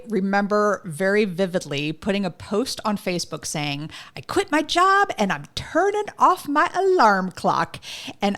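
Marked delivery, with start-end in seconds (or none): 4.2-7.8 excited announcement. Retold or imitated. Quoting her own Facebook post.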